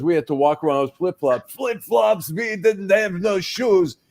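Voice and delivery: put-on a frail old man out-suffering the other guy; old Holocaust survivor. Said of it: "He goes".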